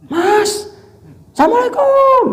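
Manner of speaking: very high yelled greeting, imitated; too loud. Demonstrating an over-loud greeting to a man, mock high yell. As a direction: holler the greeting at an exaggerated high pitch. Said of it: "Terlalu keras juga jangan".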